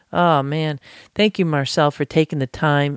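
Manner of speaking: ordinary talk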